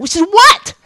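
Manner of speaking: high, loud burst of impatient curiosity; mimicked listener who can't wait; eager curiosity. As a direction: blurt it out, dying to know. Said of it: "now she's interested".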